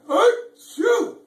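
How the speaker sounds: loud, very high mock sneeze; hammed-up elephant achoo; big sneeze. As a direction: blast out a huge sneeze. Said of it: "And he went".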